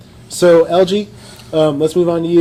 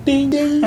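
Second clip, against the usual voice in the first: high, ringing "ding ding"; imitated bell ding; 6 semitones higher than usual. Punchline after a retold death, high bell imitation. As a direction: chime it high like a counter bell.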